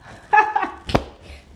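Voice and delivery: a high, loud burst of breathy laughter; excited laugh. Real, not acted. Mother laughing at her own joke to her viewers, a high loud burst. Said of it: "Non, je rigole".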